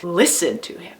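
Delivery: "Listen" stressed, "to him" falling away. Shown as a demonstration. Teacher summing up listening with your heart, stress on "Listen". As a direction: put the weight on "Listen". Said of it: "emphasis is all about listening".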